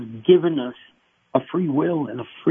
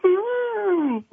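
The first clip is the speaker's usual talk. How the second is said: very high, soft crying ooh; a thin, high broken moan; wordless cry; 4 dB quieter than usual. Retold or imitated. Believer with no words left, soft high cry. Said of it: "you don't even say a word".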